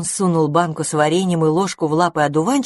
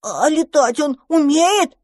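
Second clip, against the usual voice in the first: put-on eager child voice; 10 semitones higher, 4 dB louder, slower than usual. Dragon pesters dandelion.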